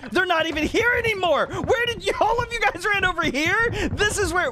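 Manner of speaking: high-pitched, baffled outburst; incredulous exclamation. Real, not acted. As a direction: demand to know where everyone went. Baffled that everyone has moved.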